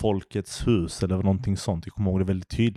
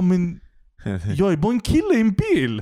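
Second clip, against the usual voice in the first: high, louder incredulous protest; exasperated disbelief; 11 semitones higher, 3 dB louder than usual. Retold or imitated. Insisting to the drunk woman he's no taxi, exasperated and high. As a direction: cry out in disbelief that it's just a guy in a car. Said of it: "Jag bara".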